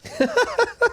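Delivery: a high burst of real laughter. Real, not acted. Laughing as he tells how he stole his passport back. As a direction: laugh out at his own cheek.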